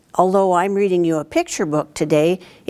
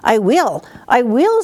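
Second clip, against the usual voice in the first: put-on eager boy; 6 semitones higher, 4 dB louder than usual. Greedy Edmund eagerly agrees.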